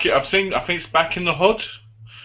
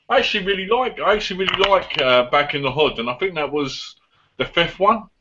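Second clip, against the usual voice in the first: ordinary delivery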